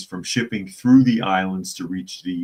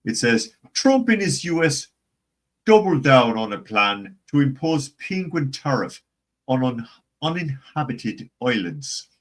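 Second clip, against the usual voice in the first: hammed-up Irish brogue, higher and lilting; mock Irish newsreader, pitched up; American-style Irish accent; 5 semitones higher than usual. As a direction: read the article in a stagey Irish accent. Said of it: "That's the American Irish".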